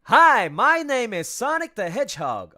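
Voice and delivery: high, loud demo line. Shown as a demonstration. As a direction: pitch it way up with energy. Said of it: "so go high with".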